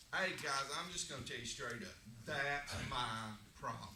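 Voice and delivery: ordinary talk, nothing marked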